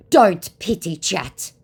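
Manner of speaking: slow, emphatic mock reproach; mock scolding. Acts out scammer's terse reply.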